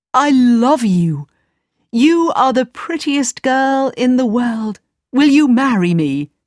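prince voice. Acted. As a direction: ardent prince proposing. Prince proposes.

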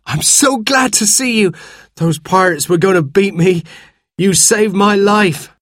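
high-pitched, gushing relief; excited cry. A narrator's reading. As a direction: pour out thanks to your rescuer. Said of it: "I cried".

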